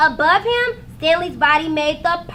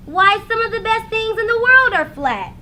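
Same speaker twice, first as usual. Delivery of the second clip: bright, higher cheerful mom voice; upbeat mother; 6 semitones higher, 5 dB louder than usual. A narrator's reading. Mother cheering up Stanley, upbeat and higher. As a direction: cheer up a gloomy son.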